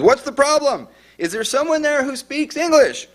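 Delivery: high-pitched, exasperated mock yell; imitated scream. Dispatcher screams at caller over background shouts, imitated scream. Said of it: "And she screams, she goes".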